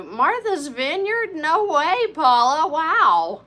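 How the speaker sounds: high, loud, drawn-out amazement; can't believe where the viewer is from; surprised delight. Real, not acted. To Paula in chat, loud high surprised delight. Viewer from Martha's Vineyard appears.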